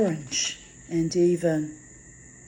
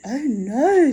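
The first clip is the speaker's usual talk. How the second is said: a raised, hurried no; quick alarmed reply; 6 semitones higher, 5 dB louder than usual. A narrator's reading. Girl hastily warns her hostess off the horses, raised and quick. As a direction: quickly stop her before the groom goes near. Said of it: "answered quickly".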